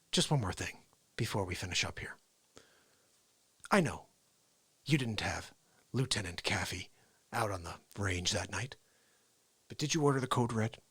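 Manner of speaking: low, hushed, halting interrogation; Tom Cruise. Acted. Lawyer questioning a colonel, soft controlled impression. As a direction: keep it quiet and measured, pausing between phrases. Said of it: "That's kinda how he sounds".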